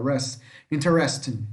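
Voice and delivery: REST singled out, then stressed inside interesting; REST shown as stressed. Shown as a demonstration. Teacher pointing out the strong syllable, interesting stressed on REST. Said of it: "A sílaba mais forte é aqui".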